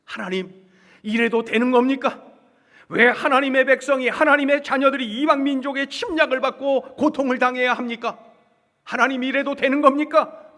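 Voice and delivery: loud, high-pitched appeal to God; prophet's impassioned complaint; acted pleading protest. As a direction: cry out in protest, building in force. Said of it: "눈물 흘리면서 호소하고".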